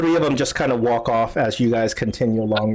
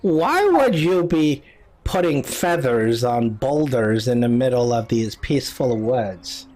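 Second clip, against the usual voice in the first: put-on slow, puzzled old woman; old lady; 2 semitones lower than usual. Old lady questions travelers about the feather, slow old lady voice. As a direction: ask it slowly as an old hut dweller. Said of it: "this old lady comes out".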